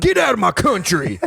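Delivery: lightly voiced angry bigot; mock xenophobe. Mocking xenophobe voice.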